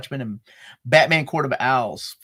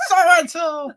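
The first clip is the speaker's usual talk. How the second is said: put-on girly voice; 6 dB louder than usual. Imitating a Teen Girl Squad character.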